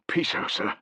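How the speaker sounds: whispered, grief-stricken; choked whisper. A narrator's reading. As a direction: barely get the name out. Soldier breaks down.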